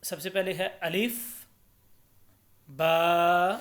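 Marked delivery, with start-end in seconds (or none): 2.7-3.5 बा with the long vowel held evenly; louder, steady chant-like बा, drawn out briefly; held one alif. Shown as a demonstration. Teacher shows learners the letter, बा held one alif. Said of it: "एक अलिफ के बराबर पढ़ना है".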